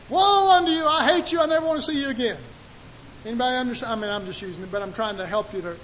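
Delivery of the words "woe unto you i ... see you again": raised, stern pronouncement; God's rebuke. Shown as a demonstration. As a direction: thunder the woe at them. Preacher voicing God's rebuke.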